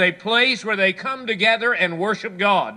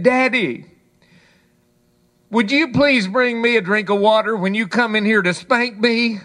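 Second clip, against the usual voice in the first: put-on higher, louder childlike plea; little boy calling; 4 semitones higher, 4 dB louder than usual. Little boy calls to his father for water, higher plea. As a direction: play a cheeky kid calling out from bed.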